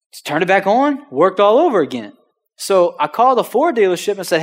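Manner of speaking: normal talking voice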